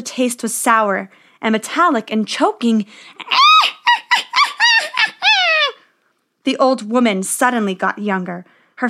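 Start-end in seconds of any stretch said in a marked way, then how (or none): 3.2-5.7 loud, very high-pitched cackle; long triumphant witch's cackle; evil witch cackle. Acted. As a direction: shriek out a wicked staccato laugh. Witch reveals herself after poisoning.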